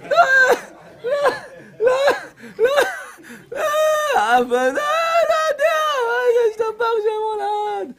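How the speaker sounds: high, crying shout through laughter; mock wailing. Rabbi mocks a crying woman, hammed-up laughing wail. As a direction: fake sob it, half laughing.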